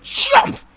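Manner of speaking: sharp high whispered chomp sound; voiced bite effect; mock chomp. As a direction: snap it out like jaws closing.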